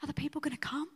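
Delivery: whispered doubt between the two of them; worried whisper. Retold or imitated. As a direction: breathe the question, afraid to be heard. Worried aside to each other.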